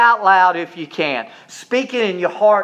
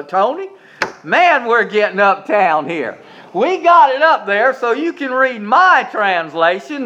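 ordinary talk, nothing marked

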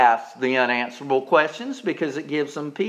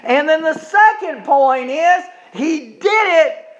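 much higher, loud preaching shout; shouted preaching climax; 13 semitones higher, 8 dB louder than usual. Acted. Preacher crying out to the congregation, loud and high. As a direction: cry it out at the peak of the sermon.

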